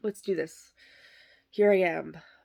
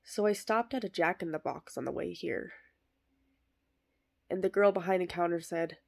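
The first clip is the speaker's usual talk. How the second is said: ordinary talk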